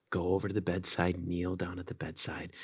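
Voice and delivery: ordinary delivery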